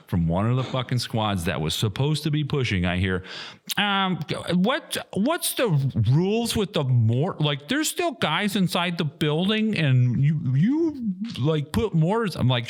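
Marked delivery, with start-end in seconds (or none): 3.8-12.4 high, timid whiny voice mocking the radio caller; mocking whiny squad member. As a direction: squeak it out like a nervous soldier.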